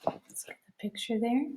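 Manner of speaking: hushed, soft; whispered aside. Real, not acted. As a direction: murmur while adjusting the book. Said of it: "aside to camera".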